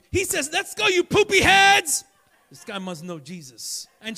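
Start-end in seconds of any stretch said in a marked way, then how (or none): 0.1-2.0 yelled, higher and louder; drill sergeant shout. Retold or imitated. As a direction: bark it at the recruits like a drill sergeant.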